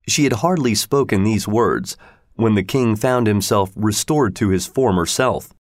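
ordinary delivery, nothing marked

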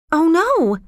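put-on high, dismayed girl's voice; acted high-pitched dismay; surprised. A narrator's reading. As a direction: react in surprise, pitched well up. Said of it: "looking surprised".

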